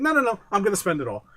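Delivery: put-on high, fast, insistent child; a kid refusing to listen; bratty kid. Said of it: "You're like".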